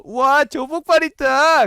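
high slow delighted cry; thrilled to spot a friend; excited. Real, not acted. Spots a friend in chat. (신난 외침)

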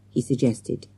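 usual speaking voice

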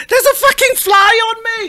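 high, shrill Cockney yelp about a fly. Retold or imitated. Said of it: "how does a voice like that".

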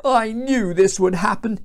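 acted disgruntled whinge; groaning complaint. A narrator's reading. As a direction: grumble bitterly. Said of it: "he groaned".

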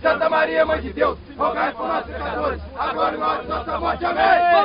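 the Lord's Prayer and Hail Mary yelled loud and together; shouted team prayer. Real, not acted. Players in the huddle shouting the prayer before kickoff, at full volume. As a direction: roar the prayer like a war cry.

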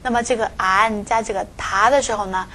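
ordinary talk

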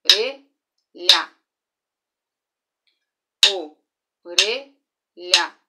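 "Orelha" as "o-re-lha", slow and loud; syllable-clapped. Shown as a demonstration. Teacher clapping syllables with the class, slow and loud. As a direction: beat out "Orelha" in three parts. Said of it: "Vamos lá".

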